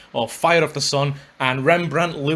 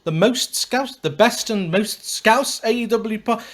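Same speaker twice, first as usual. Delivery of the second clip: testing a new opening phrase, pitched up; trying out a new line, higher; 7 semitones higher, slower than usual. Shown as a demonstration. Podcast host tries a new opening, pitched up. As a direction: rehearse the line with a hopeful lift. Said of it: "I'm on the hunt for a new opening line".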